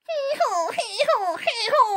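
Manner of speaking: slow, high hee-haw; donkey bray. Retold or imitated. The beaten donkey brays, slow and high. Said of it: "gitu bunyinya seperti itu ya".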